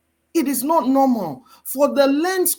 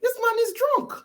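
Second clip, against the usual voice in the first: much higher-pitched acted quote; voiced Abigail; 13 semitones higher than usual. Abigail remarking on her drunk husband, high mimicked voice. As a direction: voice the wife in a high, put-on pitch. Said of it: "she was like".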